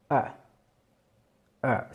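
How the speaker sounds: A as an open English vowel like in hug; phonetic sound. Shown as a demonstration. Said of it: "on retrouve le son du début". (le son A isolé)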